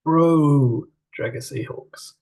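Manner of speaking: slow, drawn-out mock radio forecast; shipping forecast announcer. Co-host teasing about a team name, mock shipping forecast. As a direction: intone it like the shipping forecast, stretching the first word. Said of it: "feel like I'm on the shipping forecast".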